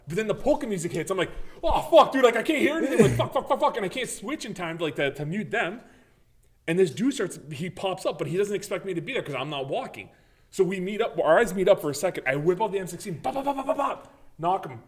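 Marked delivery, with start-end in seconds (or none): 1.6-3.8 loud, high-pitched replay of panic; cursing loudly as panic hits mid-game; panicked outburst. Retold or imitated. Acted panic as music drowns game. 13.2-13.8 high-pitched rattle of gunshot noises; rapid-fire "bop" as a gun; imitated gunfire. Imitates M16 gunfire in story.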